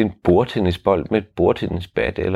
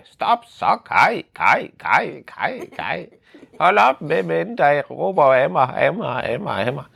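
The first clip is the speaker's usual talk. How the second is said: high, hopping-mad yell at the echo; upset shouting; 9 semitones higher than usual. Acted. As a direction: stamp and shout at Kai. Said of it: "hoppede han op og ned". (vred, hoppende råben)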